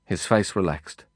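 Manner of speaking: plain reading voice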